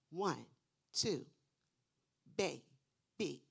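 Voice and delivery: put-on loud One, two, then Baby with energy; jumping count. Shown as a demonstration. Teacher acting out syllable jumps, loud and playful. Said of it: "Have them jump up in the air".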